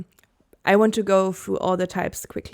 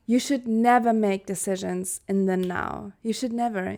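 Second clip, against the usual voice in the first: normal talking voice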